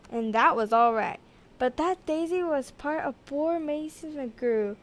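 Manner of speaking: ordinary delivery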